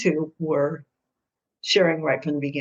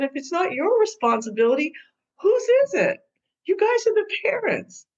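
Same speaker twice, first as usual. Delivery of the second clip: sharply rising, higher pitched challenge; pointed high question; 12 semitones higher than usual. Retold or imitated. Mediator challenging the parents, high and pointed. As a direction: throw the question back at her, high and urgent.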